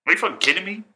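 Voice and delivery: high, exasperated disbelief; incredulous. Real, not acted. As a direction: throw up your hands in outrage. Ranting about old politicians.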